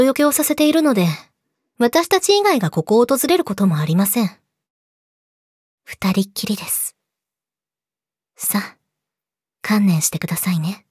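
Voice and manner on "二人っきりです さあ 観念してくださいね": low menacing whisper. Acted. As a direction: hiss the threat softly. Cornering captive boyfriend.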